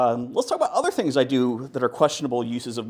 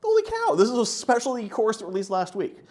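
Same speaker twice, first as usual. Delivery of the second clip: amazed; 9 semitones higher, 5 dB louder than usual. Retold or imitated. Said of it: "I'm like".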